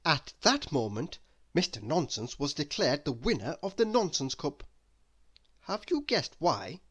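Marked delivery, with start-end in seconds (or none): none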